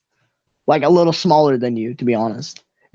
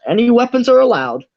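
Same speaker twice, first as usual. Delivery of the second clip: higher-pitched ticketmaster announcing a rule; lightly voiced ticketmaster, pitched up; 9 semitones higher than usual. Ticketmaster answering a fighter about weapons, higher official voice. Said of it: "the Ticketmaster says".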